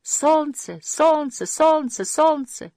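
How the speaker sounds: high, repeated echoing call; ringing echo of a shout. A narrator's reading. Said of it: "вторило, удаляясь всё дальше и дальше эхо".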